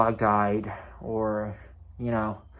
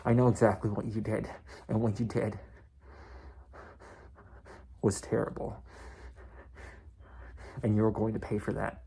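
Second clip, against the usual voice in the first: retold threat; 6 dB quieter than usual. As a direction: confront him softly, letting each part hang. Acted threat to rude customer.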